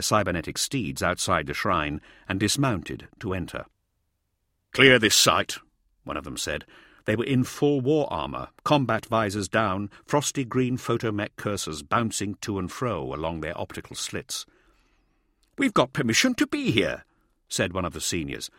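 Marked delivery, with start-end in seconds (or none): 4.7-5.6 loud military command; barked order. A narrator's reading. Soldier ordering civilians out. 15.6-17.1 higher-pitched objection; indignant protest. A narrator's reading. Senior protesting to soldiers.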